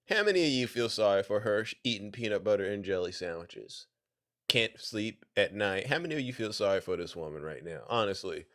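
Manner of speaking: very soft, low-pitched question; hushed, low appeal. Real, not acted. Streamer asking the audience for sympathy, hushed and low. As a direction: drop right down and ask it quietly.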